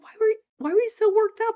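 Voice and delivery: high, quick quoted question; retold puzzled question. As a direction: imitate someone asking, pitched well up.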